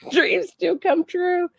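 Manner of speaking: dreams do come true, laughing it out high and slow; a slow, high, laughing cheer. Real, not acted. Gleeful about the arrest footage.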